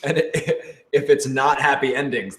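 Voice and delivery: ordinary talk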